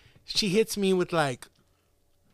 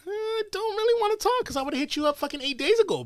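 high mock-casual brush-off; dismissive reply; 11 semitones higher, 4 dB quieter than usual. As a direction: toss it off in a high, mocking tone. Retelling reply to a girl.

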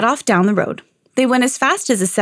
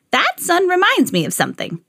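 put-on high-pitched old woman voice; storybook old woman, high voice; 9 semitones higher than usual. A narrator's reading. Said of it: "said the old woman".

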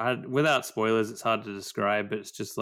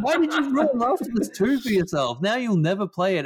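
high, loud disbelief through laughter; laughing outrage at a spoiler-loving friend; exasperated laughing outburst; 10 semitones higher, 4 dB louder than usual. Real, not acted. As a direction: laugh while yelling at a friend.

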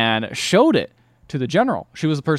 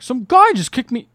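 aggrieved complaint; 11 semitones higher, 5 dB louder than usual. Retold or imitated. Angry guest complains to Kevin.

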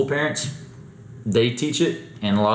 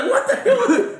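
mock woman; much slower than usual. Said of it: "She's like".

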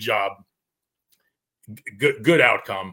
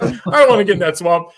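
higher, laughing through a reluctant whine; reluctant deputy, laughing; 7 semitones higher, 5 dB louder than usual. Retold or imitated. Retelling the deputy dreading the swamp, with a laugh. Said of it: "He's like".

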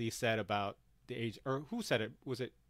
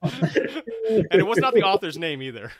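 louder, higher, laughing through the words; 6 semitones higher, 12 dB louder than usual. Real, not acted. Host laughing at the agent's first draft, loud and amused. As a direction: insist while cracking up.

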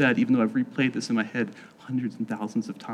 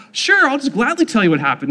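high, loud, happy yes; eager agreement; 10 semitones higher, 6 dB louder than usual. Retold or imitated. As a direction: jump at the offer. Eager reply to the youth leaders.

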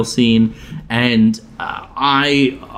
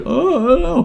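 high, wavering "oh"s; moaned like a crying moviegoer; mock crying. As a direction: imitate yourself tearing up at a sad scene. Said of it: "I'm like".

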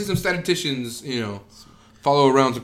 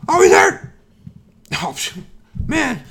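Joe Rogan impression; 5 semitones higher, 7 dB louder than usual. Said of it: "it's too high at the end".

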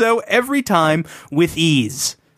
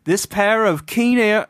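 put-on Welsh accent; 3 semitones higher than usual. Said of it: "I can't read far enough ahead with this voice".

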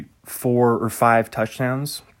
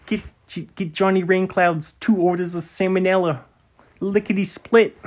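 silly character voice; 7 semitones higher than usual. Pretend. Ordering food for Johnny Rainclouds.

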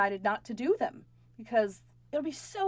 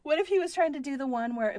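usual speaking voice

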